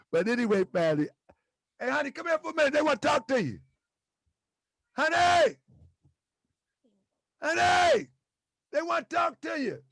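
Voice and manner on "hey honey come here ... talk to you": higher-pitched call to someone nearby. Real, not acted. Speaker calls honey over to meet the viewers, calling out.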